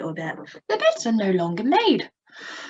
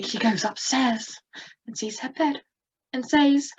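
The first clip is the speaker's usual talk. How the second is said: ordinary delivery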